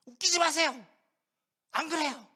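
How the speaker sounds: loud, high protest; indignant Peter. Acted. Peter denying Jesus' warning, loud high protest. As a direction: brush it off with outrage.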